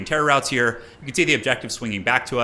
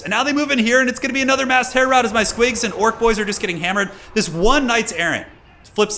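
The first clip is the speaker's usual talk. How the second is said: tense hype; 9 semitones higher, 3 dB louder than usual. Real, not acted. Tense fight commentary.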